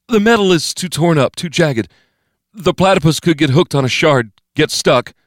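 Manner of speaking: high, pressing younger-man voice; anxious protest. A narrator's reading. Steve warning Bo Pan about the torn metal, anxious high voice. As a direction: argue urgently, pitch way up. Steve argues against going inside.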